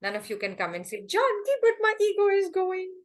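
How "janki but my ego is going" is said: high, whining protest; mock whiny complaint. Student whining to Janki about losing ego, mock high whine. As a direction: play a student whining to the teacher.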